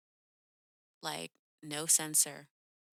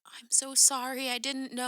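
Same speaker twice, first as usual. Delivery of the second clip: higher-pitched imitation of an upset apology; tearful apology; 7 semitones higher than usual. Fan apologizing to the rapper on stage, mildly imitated tearful plea. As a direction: plead lightly, as if close to tears. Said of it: "her eyes well up with tears".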